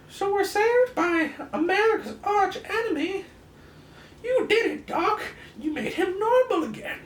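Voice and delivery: put-on very high, loud excited kid voice; squeaky sidekick. Rick Jones cheering the doctor, in a very high, loud squeaky voice. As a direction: squeal it like an eager boy sidekick.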